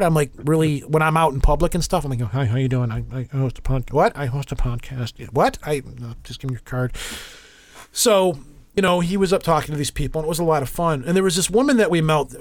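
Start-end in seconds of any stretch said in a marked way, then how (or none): 2.2-6.9 awkward recluse. Retold or imitated. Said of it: "I'm kind of recluse for the most part".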